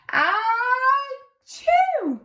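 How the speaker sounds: high, hammed-up achoo sneeze; big sneeze. Narrator reading to child, acting out the sneeze. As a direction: act out an almighty sneeze.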